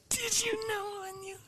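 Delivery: crying with laughter, soft; laughing. Real, not acted. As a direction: gasp it out through laughter. Laughing at own blunder.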